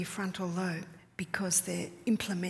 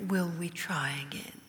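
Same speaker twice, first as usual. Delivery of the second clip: put-on gentle question to baby; 4 semitones lower than usual. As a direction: ask it tenderly, nearly breathed. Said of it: "I look down at him and I say".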